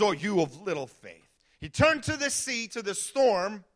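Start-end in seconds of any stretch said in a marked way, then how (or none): 0.0-1.2 much lower, calm Jesus rebuking the scared disciples; Jesus to his frightened disciples, calm low rebuke; gentle rebuke. Acted. Jesus got up and says.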